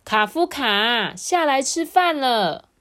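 high, drawn-out mom's dinner call; loud call. Acted. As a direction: call the child down loudly and slowly. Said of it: "妈妈也大声说".

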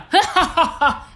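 high, light giggle; high tinkling laugh. Shown as a demonstration. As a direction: laugh like a little bell. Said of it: "所以就会是这样".

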